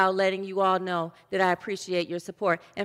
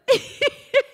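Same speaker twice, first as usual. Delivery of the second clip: loud, high-pitched laugh; 5 dB louder than usual. Real, not acted. Preacher amused by the joke just told, real laughing.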